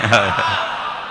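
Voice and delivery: short, amused laugh; real laugh after the reveal; hearty laugh. Real, not acted. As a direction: laugh at your own punchline. Laughs at his own punchline.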